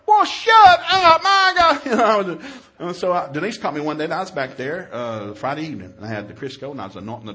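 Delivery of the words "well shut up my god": high, slow, drawn-out mock yell; fed-up shout. Dad silencing his complaining daughter, fed up. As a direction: explode in comic exasperation.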